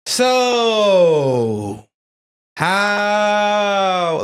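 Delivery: words drawn out high and long; mock slow talk. Shown as a demonstration. Joking to co-host about talking slow on the phone, exaggerated drawl. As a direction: stretch each word out, slow and loud. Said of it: "You gotta, you gotta talk quietly".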